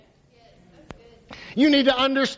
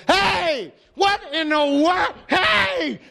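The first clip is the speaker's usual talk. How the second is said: panicked yelling, higher and higher; freaking out; 7 semitones higher, much slower than usual. Retold or imitated. Servant panicking at the surrounding army, alarmed shout. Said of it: "He's freaking out".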